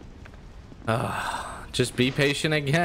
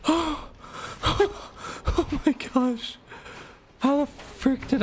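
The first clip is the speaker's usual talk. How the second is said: much higher, squealing with real joy; ecstatic victory shriek; slower than usual. Real, not acted. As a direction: shriek out the disbelief of finally winning. Finally beats the boss, screaming.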